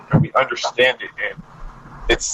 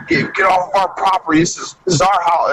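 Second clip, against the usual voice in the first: imitating homeowners; 5 semitones higher than usual.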